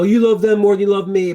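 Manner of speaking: put-on a child's high, loud accusing whine; mock child complaining to a parent; kid's whine. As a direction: whine it like a jealous kid. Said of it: "kids will go".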